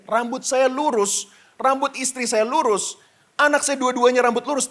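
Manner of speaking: normal talking voice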